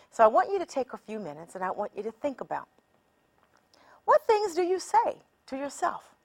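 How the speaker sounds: usual speaking voice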